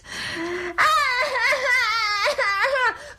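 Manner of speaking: put-on long wordless toddler wail; child crying. Toddler upset during sister's phone call, crying. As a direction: bawl in waves. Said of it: "Cole, stop it!".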